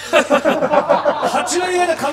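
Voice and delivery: laughing through the words. Real, not acted. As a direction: talk while cracking up. Laughing at a joke.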